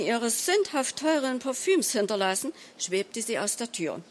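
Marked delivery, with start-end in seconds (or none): none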